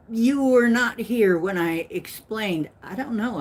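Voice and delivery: ordinary delivery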